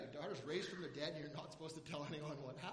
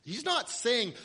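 ordinary talk, nothing marked

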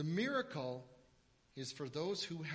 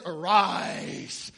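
louder, lofty proclamation of Arise; grand King James; 17 dB louder than usual. Pretend. Mocking the grand English Bible wording, lofty and loud. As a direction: boom it like old scripture. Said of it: "it sounds so grand in English".

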